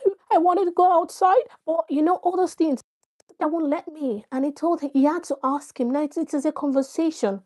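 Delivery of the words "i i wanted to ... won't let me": a hint of a child complaining it can't go outside; imitating a sulky kid, mildly; whiny child. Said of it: "you know how children are".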